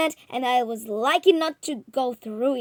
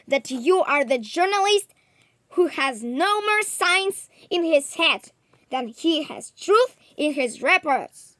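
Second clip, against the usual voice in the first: higher and louder, a furious roar; sudden angry roar; 5 semitones higher, 8 dB louder than usual. A narrator's reading. Professor roaring at the journalist, angry roar. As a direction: let the anger burst out and roar it. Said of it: "he roared with a sudden anger".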